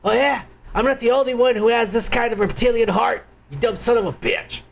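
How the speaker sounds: normal talking voice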